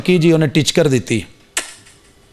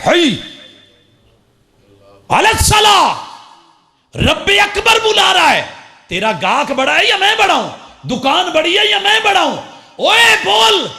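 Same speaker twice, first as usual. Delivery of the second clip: thundering summons; 8 semitones higher than usual. Acted. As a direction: demand who is greater, at full force. God's call voiced as stern summons.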